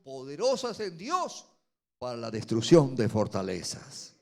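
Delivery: loud, rising pulpit sermon; preaching at full force. Acted. Preacher warning the congregation against pride, at full force. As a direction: hammer the lesson home to the congregation.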